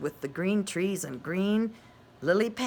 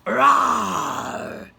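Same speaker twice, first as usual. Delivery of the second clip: loud roaring lion; 5 semitones higher, 12 dB louder than usual. A narrator's reading. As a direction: let out a big lion roar. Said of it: "said the lion".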